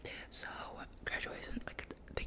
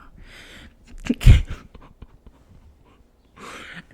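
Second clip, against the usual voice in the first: whispered laugh; 17 dB louder than usual. Real, not acted. Embarrassed about teacher crush.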